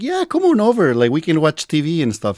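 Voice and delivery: high-pitched imitation of girls; girls chatting online. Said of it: "try to talk to girls".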